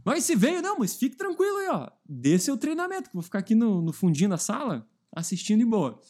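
high, startled, overly polite; flustered trainer. Retold or imitated. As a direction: caught off guard, high nervous voice. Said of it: "O cara já ficava assim".

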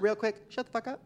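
high, loud fake-sweet interruption; high mock-polite scolding; mock polite then snappy. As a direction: sweetly tell them off. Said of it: "I was almost like".

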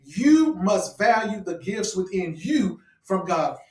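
"you" drawn out and hit hard; deliberate line, high emphatic "you"; stress on YOU. Real, not acted. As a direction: slowly preach it, punching each "you". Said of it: "I'm emphasizing on the you".

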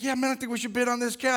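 quick, excited yes from a buddy; eager agreement. Retold or imitated. Buddy urging a bid on the cow, fast and eager. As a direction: jump at the deal, fast and keen.

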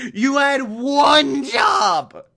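high, loud and slow exasperated shout; slow, loud, high-pitched scolding; mock furious yell again. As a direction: bellow at the useless butler. Yelling at Alfred over failure.

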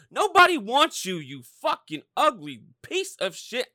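loud, high, fast screaming rant; furious shout. Real, not acted. Cursing out the traded quarterback, screaming furiously. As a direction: explode and keep hammering every line.

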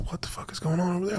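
fast, hushed whisper, half under the breath; a quick nervous whisper while creeping closer. Retold or imitated. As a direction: whisper it like you're sneaking up. Sneaking toward a gunfight.

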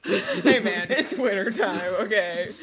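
laughing through the words. Real, not acted. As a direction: talk while cracking up. Laughing through defence of sniffles.

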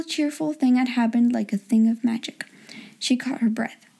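ordinary delivery

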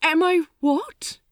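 flustered bewildered question; total confusion. Acted. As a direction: stammer it out, utterly lost. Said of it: "he asked in total confusion".

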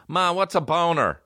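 put-on high-pitched little-kid question; imitated young kid. Young cousin asks mom what's a boner, high mock-kid voice. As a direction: voice a kid asking his mom.

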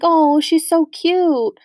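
high, loud, drawn-out mock cooing; imitated gushing. Dad shown a picture of the puppy, imitated high gushing. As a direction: coo slowly over a puppy, playing dad.

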